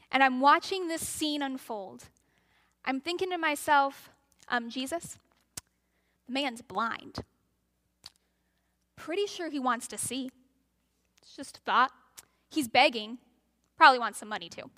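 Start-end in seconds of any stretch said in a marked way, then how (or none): 4.7-14.7 dry, halting aside, pitched low; sceptical onlooker. Acted. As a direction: politely point out the obvious, with awkward pauses. Voices sceptical onlooker's dry thoughts.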